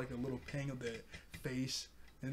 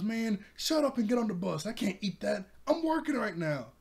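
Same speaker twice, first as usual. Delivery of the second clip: high, loud irritated yell; acted annoyed shouting, pitched well up; Bakugo yelling; 7 semitones higher, 5 dB louder than usual. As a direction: imitate a hothead yelling at an old lady. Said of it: "Bakugo's still yelling at the lady".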